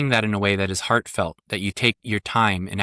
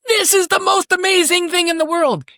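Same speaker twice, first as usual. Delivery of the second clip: put-on high, loud, wide-eyed child gushing; excited child voice; 5 dB louder than usual. A child gushing to daddy, high and loud. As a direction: squeal it like a thrilled little kid. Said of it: "sometimes they're like".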